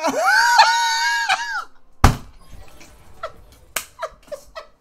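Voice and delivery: long, loud, high-pitched laughing fit; hysterical laugh. Real, not acted. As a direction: laugh until it squeals. Laughing fit at friend's rage.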